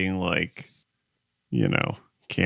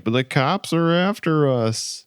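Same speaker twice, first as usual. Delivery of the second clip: higher, drawn-out mock protest; lightly acted panic, pitched up; mildly freaking out; 7 semitones higher, slower than usual. Telling driver cops are after them, mock freaking out. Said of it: "they're freaking out".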